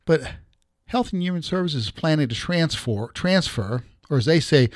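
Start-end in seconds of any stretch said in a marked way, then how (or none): none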